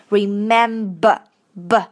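slow "remember" with a voiced "b" brought out; voiced b demo. Shown as a demonstration. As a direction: drill the "b" in "remember". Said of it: "这个b的浊辅音要出来".

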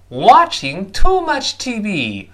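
"Watching too much T V" slow, every word punched high and loud; stressed content words. Shown as a demonstration. Teacher shows which words are stressed, "Watching too much T V" slow and emphatic. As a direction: lean hard on each word of "Watching too much T V", falling at the end. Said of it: "后面几个单词都要重读".

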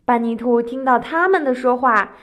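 plain reading voice

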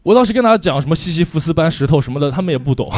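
usual speaking voice

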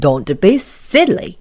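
loud, scornful snort; rude, loud put-down. Acted. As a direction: dismiss it loudly with scorn. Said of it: "snorted Mister Uppity".

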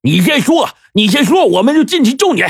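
high urgent shout through the door; calling out loud. A narrator's reading. Gang boss shouting through the door, high and urgent. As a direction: yell in through a shut door. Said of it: "连忙在外面高喊".

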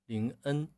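林恩 said as Lin-eng with a back nasal ng; 林恩 ending in ng, the student's error; 林恩 ending in ng, not en. Shown as a demonstration. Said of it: "你是发的后鼻音".

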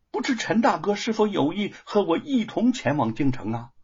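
high-pitched eager invitation; sudden inspiration. A narrator's reading. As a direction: propose it as a bright sudden idea. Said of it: "忽然灵机一动".

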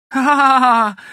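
loud, high acted laugh; gloating laugh. A narrator's reading. Boy laughs at the flipped turtle, gloating.

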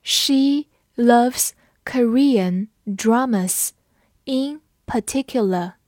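slow, separate words with gaps; measured teacher's model for repeating; slow read-along. Shown as a demonstration. Teacher models a sentence for learners, slow word-by-word reading. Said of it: "跟我慢读一遍".

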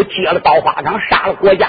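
ordinary delivery

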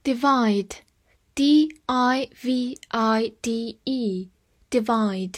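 slow, clear dictation of the word; slow spelling. Shown as a demonstration. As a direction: spell it out slowly, letter by letter. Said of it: "spells and says the word slowly". (慢速拼读)

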